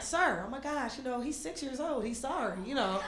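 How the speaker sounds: hushed, placating voice; meek apology. Retold or imitated. Apologizing to irate man for six-year-old cousin, meek and quiet. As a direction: soften right down to appease him.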